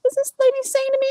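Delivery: high, quick, incredulous aside; incredulous reaction, replayed fast; retold disbelief. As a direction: pitch leaps up in mock outrage. Retold disbelief at caller's nerve.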